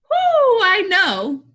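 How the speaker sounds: high, loud whoop into words; forced composure. Acted. Teacher resuming speech to class, high forced cheer. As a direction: sing out a big release before resuming.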